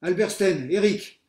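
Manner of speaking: loud high name call; teacher shouting the roll. A narrator's reading. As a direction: call across the ruins.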